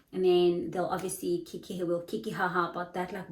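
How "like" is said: usual speaking voice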